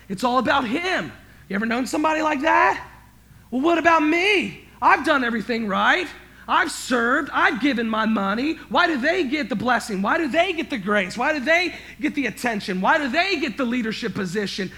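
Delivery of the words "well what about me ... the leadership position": loud, high self-pitying whine; whiny elder brother. Acted. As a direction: complain like the one who did everything right. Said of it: "That's elder brother stuff".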